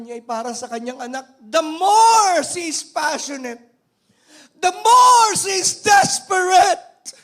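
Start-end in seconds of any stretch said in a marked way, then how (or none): 1.5-7.1 much higher, louder and slow; preaching shout. Real, not acted. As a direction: build to a passionate roar. (pasigaw na pangangaral)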